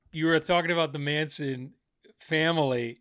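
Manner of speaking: ordinary delivery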